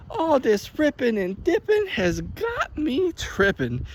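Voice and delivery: put-on high, slow, cheeky kid voice; mock little brother. First take of the little brother's rhyme, high and slow. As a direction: play the smart-mouthed little brother. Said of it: "he's like".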